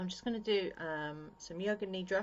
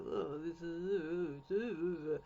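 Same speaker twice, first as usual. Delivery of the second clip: long, slow, drawn-out wordless drone; wandering brain noise; much slower than usual. Acted. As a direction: drag it out slowly like a mind drifting off. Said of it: "doesn't give your brain chance to go".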